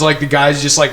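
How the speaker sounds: normal talking voice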